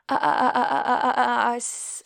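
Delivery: mocking his stutter with a stammered uh uh; mocking the shy guy who can't speak. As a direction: imitate him stammering, cruelly. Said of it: "are you s-, stuttering".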